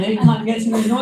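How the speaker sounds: ordinary talk, nothing marked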